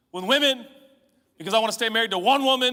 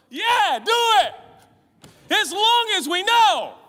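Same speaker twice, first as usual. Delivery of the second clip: higher, louder, slow hearty encouragement; mock pastor cheer; 11 semitones higher, 7 dB louder, slower than usual. Preacher mocking his younger pastor self, loud and hearty. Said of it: "I was the pastor at the coffee going".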